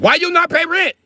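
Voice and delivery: high, loud broken-English landlord; squeaky stingy landlord demanding rent. Retold or imitated. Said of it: "you be like".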